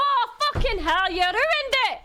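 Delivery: real excited yelling. Real, not acted. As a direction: shriek it out in real disbelief. On-stream sub goal shock.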